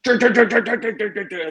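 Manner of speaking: high staccato juddering noise; imitated seizure. Storyteller acts out his full-on seizure, juddering. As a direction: mimic convulsions in rapid high pulses. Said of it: "having a full-on seizure".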